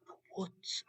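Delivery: whispered echo; hushed whisper. Pretend. Storyteller telling children where the tiger lives, whispering. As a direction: whisper it with a sense of mystery. Suspense, echoing the answer.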